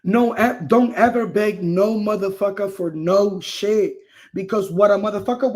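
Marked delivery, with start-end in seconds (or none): none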